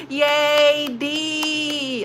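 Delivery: high, drawn-out celebratory yay; excited cheer. Real, not acted. Streamer celebrates viewer becoming a mod, excited cheer. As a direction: cheer them on, stretched out.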